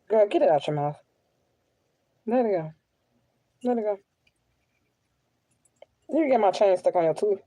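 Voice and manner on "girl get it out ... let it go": normal talking voice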